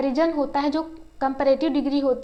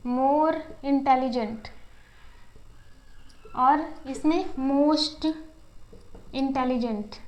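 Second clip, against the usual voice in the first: slow, careful model; much slower than usual. Shown as a demonstration. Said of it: "intelligent को देखिए".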